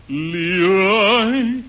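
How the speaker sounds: normal talking voice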